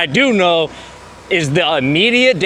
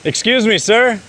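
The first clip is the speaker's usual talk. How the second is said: excited friendly greeting; 8 semitones higher, slower than usual. Real, not acted. Greeting a stranger's dog.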